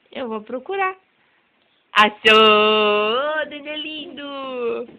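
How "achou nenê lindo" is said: excited peekaboo. Real, not acted. Peekaboo reveal.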